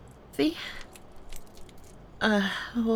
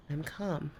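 much softer, settling the voice down; softer calm; 3 semitones lower, 9 dB quieter than usual. Real, not acted. Softly assuring the angry partner she is calm. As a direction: bring it right down to prove the calm.

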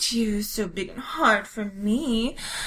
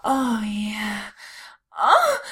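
ordinary delivery

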